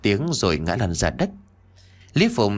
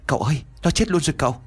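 hurried henchman; 6 semitones higher, much faster than usual. Acted.

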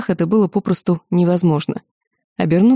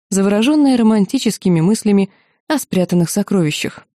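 usual speaking voice

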